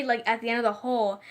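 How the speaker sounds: ordinary talk, nothing marked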